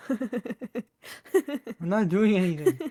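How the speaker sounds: slow, sing-song feigned innocence; drawn-out mock innocence. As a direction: drag out each word innocently. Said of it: "why he's acting so strange".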